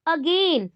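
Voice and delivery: "again" with a long, weighted gain; light a, then lean on gain in "again"; stress on second syllable. Shown as a demonstration. Teacher showing second-syllable stress, stressing gain in "again". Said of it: "Stress on the second syllable".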